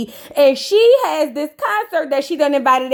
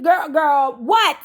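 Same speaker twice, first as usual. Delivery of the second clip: ordinary delivery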